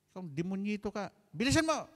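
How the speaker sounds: barking father. Retold or imitated. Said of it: "tatay na lasing".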